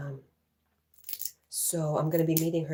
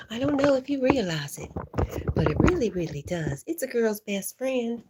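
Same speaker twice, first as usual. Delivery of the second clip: playful excitement; 7 semitones higher, 7 dB louder than usual. Acted. Playful sales pitch about duct tape.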